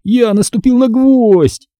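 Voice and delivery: high, drawn-out cry of distress; slow, high-pitched lament; pained wail. A narrator's reading. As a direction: wail it out, hurt and upset. Boy hurt, foot bleeding.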